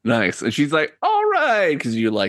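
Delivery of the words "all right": put-on high-pitched old-lady approval; old woman pleased. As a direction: draw it out up high. Old woman reacts to gold slapped down.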